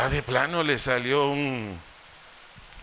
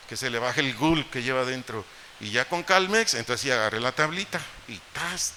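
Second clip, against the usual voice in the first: normal talking voice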